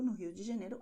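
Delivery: ordinary talk, nothing marked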